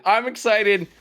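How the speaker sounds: excited. Real, not acted. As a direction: pump it up the second time.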